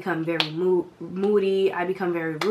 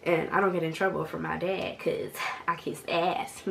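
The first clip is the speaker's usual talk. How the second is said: ordinary talk, nothing marked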